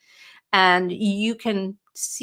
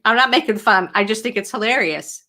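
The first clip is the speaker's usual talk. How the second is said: normal talking voice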